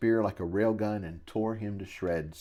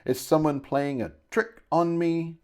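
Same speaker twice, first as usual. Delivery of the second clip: higher-pitched indignant question; blustering; 6 semitones higher than usual. A narrator's reading. Shopkeeper suspecting a trick, blustering. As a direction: demand it in a huff. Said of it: "he blustered".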